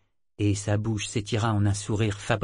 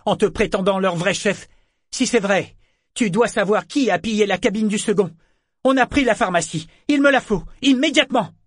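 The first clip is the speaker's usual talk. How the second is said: loud, much higher furious tirade; angry demand; 10 semitones higher, 6 dB louder than usual. Acted. Pirate woman threatening the priest, furious. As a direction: push him harder with each line.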